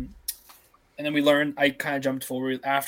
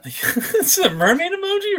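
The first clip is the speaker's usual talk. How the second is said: laughing; 6 dB louder than usual. Real, not acted. Laughing at silly emoji.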